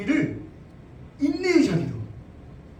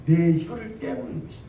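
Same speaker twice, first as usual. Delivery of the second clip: ordinary talk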